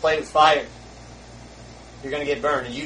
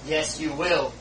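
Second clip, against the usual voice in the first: ordinary talk, nothing marked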